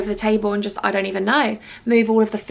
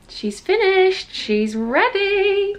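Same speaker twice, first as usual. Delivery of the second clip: higher, slow showing-off tone; proud sing-song; 8 semitones higher, much slower than usual. Acted. Showing viewers her finished base, slow proud sing-song. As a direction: present it proudly.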